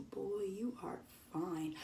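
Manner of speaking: slow, hushed, breathy admiration; admiring whisper. Retold or imitated. Fan seeing Jonah walk in, whispering slowly. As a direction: hush it like a secret crush.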